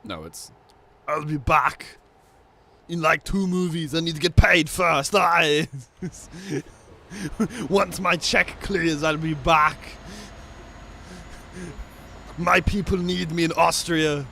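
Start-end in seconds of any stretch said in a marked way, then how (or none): 1.1-5.7 louder, higher put-on action-star voice; Arnold Schwarzenegger impression. Gamer mimics Schwarzenegger demanding pay, loud hammed accent. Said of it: "he's from Austria". 7.7-14.2 slow, louder, higher put-on star voice; Arnold Schwarzenegger impression. Gamer mimics Schwarzenegger about Austria, slow loud put-on voice. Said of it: "he's from Austria".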